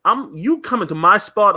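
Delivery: normal talking voice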